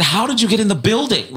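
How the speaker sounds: high, astonished question; baffled question. Retold or imitated. Caller retelling his question to Jesus, high astonished. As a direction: ask it truly baffled.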